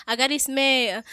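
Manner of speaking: normal talking voice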